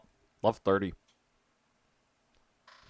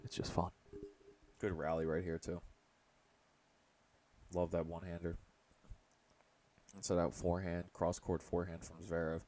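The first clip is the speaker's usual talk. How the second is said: hushed golf announcer murmur; golf commentator; 5 semitones lower, 11 dB quieter than usual. Pretend. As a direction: softly call it like golf on TV. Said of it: "talking like a golf commentator and just whisper into the microphone".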